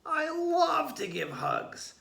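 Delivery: very high, slow, sweet creature voice; a squeaky snail who adores hugs. A narrator's reading. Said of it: "said the snail".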